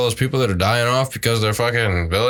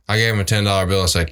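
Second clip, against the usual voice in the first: normal talking voice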